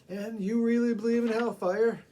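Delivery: high, loud, leading question; man needling the preacher about hellfire; pointed irony. Acted. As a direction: set up the joke.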